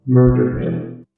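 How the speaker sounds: very slow, distant, sinister whisper-like read. Acted. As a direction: drag out the command, eerie and cold. Reading the deadly paper note.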